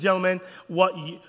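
usual speaking voice

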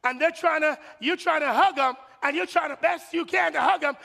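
ordinary talk

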